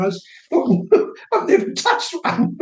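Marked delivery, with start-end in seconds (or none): 0.5-2.6 laughing high through the words; amused high-pitched laugh. Retold or imitated. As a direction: giggle it out in disbelief. Laughing at his own bluff.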